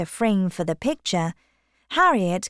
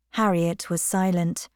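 usual speaking voice

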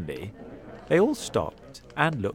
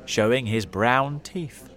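plain reading voice

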